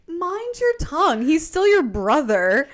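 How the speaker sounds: measured indignation, starting high; retold scolding. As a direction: lightly act out a sister's reprimand. [name] retold scolding her brother.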